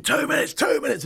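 high-pitched mock yell; imitating a loadmaster's shouted warning; urgent high call, modest in volume; retold scream. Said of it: "the loadies screaming".

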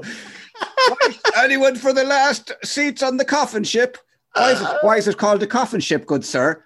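high, loud, slow hawker's call; hammed-up shipping clerk calling out to emigrants; hammed-up ship hawker. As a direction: cry out to the harbour crowd. Mock harbour ticket seller.

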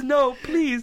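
high, pleading lady voice; mock horror. Storyteller repeating the passenger's refusal, high mock pleading. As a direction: replay her frightened plea. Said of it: "it was one of sheer horror".

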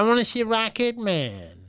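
high, insistent mock demand; childish demand. Donald telling his host which film, high childish demand. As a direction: imitate an overgrown child, slow and high.

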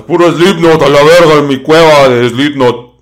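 shouted boast in a different voice; loud mocking fan voice. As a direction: ham it up like a proud metalhead. Mocking a [name]-fan's boast.

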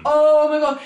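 Oh my God, like the chill just hit you; excited gasp. Real, not acted. Cohost recalling a creepy quote, excited burst. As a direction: jump up high and loud, thrilled and spooked.

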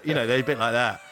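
normal talking voice

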